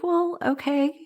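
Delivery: high, small resigned okay with a laugh; sheepish giving in. Retold or imitated. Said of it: "and I was like".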